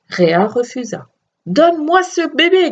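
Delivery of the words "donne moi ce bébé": shouting. A narrator's reading. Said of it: "cria-t-il".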